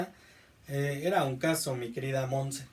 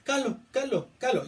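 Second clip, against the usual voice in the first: put-on calo, calo, calo, pitched higher like an excited little kid; imitating a child; 8 semitones higher than usual. Teacher imitating a child shouting calo at a car. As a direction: mimic a child pointing at a passing car. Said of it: "pasa un carro y te dice".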